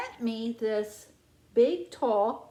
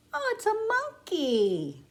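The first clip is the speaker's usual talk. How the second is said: surprised; 10 semitones higher, 3 dB louder than usual. Pretend. Monkey reveal.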